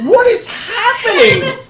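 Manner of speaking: high, loud, drawn-out disbelief; flabbergasted. Acted. Town elder arriving at the chaotic scene, flabbergasted. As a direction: yell it in hammed-up shock. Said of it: "he's just flabbergasted".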